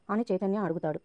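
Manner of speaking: usual speaking voice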